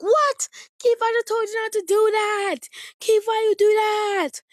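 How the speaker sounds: big sister shouting in outrage; angry yell. Retold or imitated. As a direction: yell at a brother who broke the cup. Finds cup top broken off.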